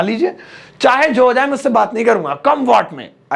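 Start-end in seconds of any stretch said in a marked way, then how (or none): none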